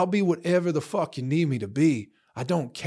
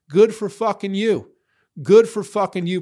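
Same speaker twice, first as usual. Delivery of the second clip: louder and higher, biting sarcasm; sarcastic anger; 5 semitones higher, 6 dB louder than usual. Real, not acted. Ranting at online critics, angry sarcastic outburst. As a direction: spit it back at them, fed up.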